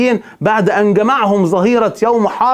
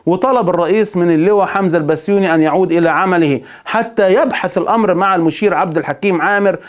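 normal talking voice

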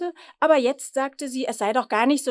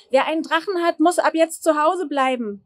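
louder, higher shout through the house; bellowing announcement; 5 semitones higher, 6 dB louder than usual. A narrator's reading. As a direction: bellow the new rule to the whole household. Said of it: "brüllte er durch die Wohnung".

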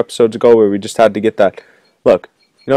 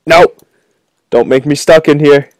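ordinary talk

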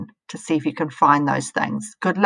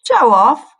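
loud, higher scolding cry; 7 semitones higher, 9 dB louder than usual. Acted. Squirrel telling off the slug, loud scolding. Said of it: "scolded a squirrel".

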